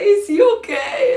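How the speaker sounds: high, loud question squeezed out through laughter; laughing. Real, not acted. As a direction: ask while you can't stop laughing. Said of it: "I was laughing so much".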